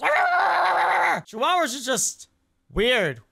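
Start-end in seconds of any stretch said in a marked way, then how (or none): 0.0-1.2 loud rolling imitation of a vicious little dog; higher-pitched mock dog growl; Chihuahua snarl. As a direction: mimic a Chihuahua's snarling outburst. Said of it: "And this Chihuahua goes".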